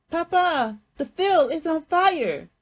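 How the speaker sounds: high, loud urgent cry; child bursting in, shouting the news; alarmed shout. A narrator's reading. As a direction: yell the alarm from the doorway.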